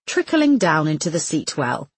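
ordinary delivery, nothing marked

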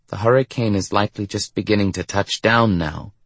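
plain reading voice

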